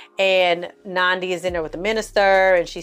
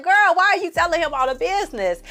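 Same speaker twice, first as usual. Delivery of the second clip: incredulous friend; 10 semitones higher than usual. Retold or imitated. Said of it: "It's like".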